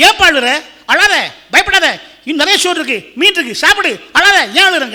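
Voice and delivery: loud, high-pitched urging; urgent consoling. Acted. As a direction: act out officials fussing over a weeping man. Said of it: "கேட்டாங்களாம்".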